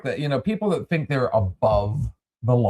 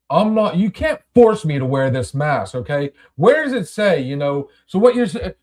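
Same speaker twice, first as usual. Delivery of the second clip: mock indignant customer; 6 semitones higher, 5 dB louder than usual. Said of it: "the guy's like".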